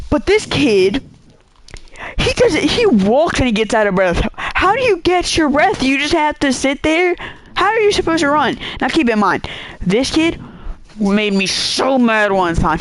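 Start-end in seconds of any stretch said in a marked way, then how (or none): 4.4-8.6 high, mocking disbelief at a winded teammate; incredulous mockery. Kid mocks a teammate winded from walking, incredulous and high. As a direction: throw the question up high, baffled and scornful.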